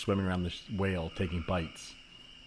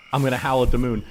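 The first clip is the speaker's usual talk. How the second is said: loud, higher, feral; wild shout; 3 semitones higher, 9 dB louder, faster than usual. Acted. The husband, feral in the woods, shouting to the moon. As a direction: let the animal out.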